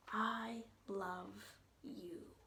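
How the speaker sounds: slow, soft whispered line. A narrator's reading. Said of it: "So we can whisper".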